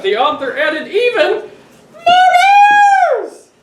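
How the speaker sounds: rising to a high, loud shout; building to a shout. Acted. Dad building up to a high, loud shout for the kids. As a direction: build it up and yell the last words.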